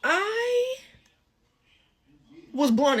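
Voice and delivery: a drawn-out, very high "I" before "was blown"; stunned. Real, not acted. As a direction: stretch out the shock on "I". Stunned reaction to the bill.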